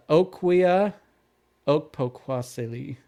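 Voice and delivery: OKWIA, pause, OKPOKWASILI as "oke-poke-WAH-sih-lee"; careful name pronunciation. Shown as a demonstration. Host repeating a name as heard in videos, OKWIA OKPOKWASILI carefully. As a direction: sound out OKWIA slowly, then OKPOKWASILI. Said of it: "the way I heard it was".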